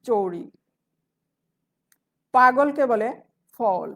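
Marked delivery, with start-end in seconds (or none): 0.0-0.4 চৌড়ি said with the middle k of চাকুরি dropped; dialect form. Shown as a demonstration. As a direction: say চৌড়ি, leaving out the k. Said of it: "চাকুরিকে বলে".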